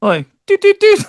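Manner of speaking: rising, sing-song doot doot; mock doot noises. Joking about tucking, goofy doot doot sounds. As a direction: toot it out like a silly sound effect.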